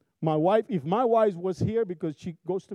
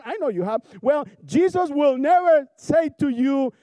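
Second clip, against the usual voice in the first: ordinary talk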